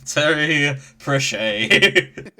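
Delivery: "Pratchett" as pruh-SHAY, very slow and loud; mock French pronunciation. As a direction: draw out "Terry Pratchett" in fake French, ending SHAY. Copies the mock French name.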